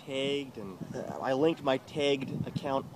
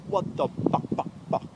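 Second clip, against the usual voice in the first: retold anger; 6 semitones higher, 4 dB louder than usual. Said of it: "I'm like".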